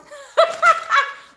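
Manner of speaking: high, bright giggling laugh; burst of laughter. Real, not acted. Laughing at chat saying she sounds Southern, high giggle. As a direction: crack up laughing at the comment.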